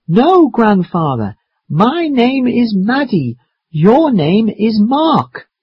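high, drawn-out correction; slow and loud. Acted. Maddie corrects grandfather's mistake, slowly and loudly. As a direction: correct him slowly, pitched well up. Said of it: "He asks me to speak slowly and loudly".

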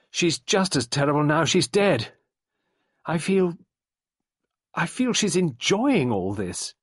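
high, mildly agitated dread; a touch of rising horror; horrified. A narrator's reading. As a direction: let the dread lift the voice high. Sarah's horror of dead woman rises.

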